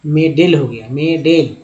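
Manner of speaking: मेडेल as "may-dale", long "ay" vowels; A read as "ay". Shown as a demonstration. Teacher shows the mistaken reading, "ay" in मेडेल. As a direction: draw out मेडेल as "MAY-DEL". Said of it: "ए का उच्चारण ए किया गया".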